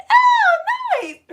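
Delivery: very high, loud "oh"; delighted squeal. Real, not acted. Learning viewers joined a second time, delighted squeal.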